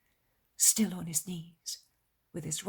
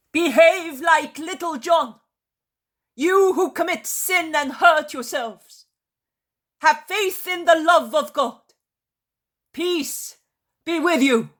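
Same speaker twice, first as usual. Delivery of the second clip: loud, high-pitched proclamation; thundering voice; 11 semitones higher, 15 dB louder than usual. A narrator's reading. Jesus addressing the calmed crowd, thundering voice. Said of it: "Jesus says in a thundering voice".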